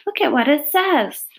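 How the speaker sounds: put-on high-pitched, eager child's exclamation; excited cry. A narrator's reading. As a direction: call everyone over in amazement, pitched high. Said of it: "cried Fern".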